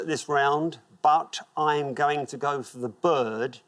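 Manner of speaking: usual speaking voice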